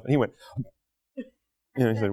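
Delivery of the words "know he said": ordinary talk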